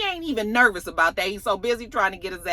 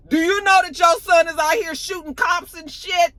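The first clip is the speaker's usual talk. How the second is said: ordinary talk, nothing marked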